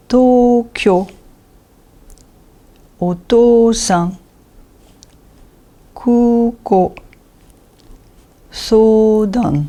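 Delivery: each long vowel held on an even tone; word by word, stretching the long vowels. Shown as a demonstration. Teacher reads examples of the rule, long vowels held. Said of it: "Donc O va se prononcer O".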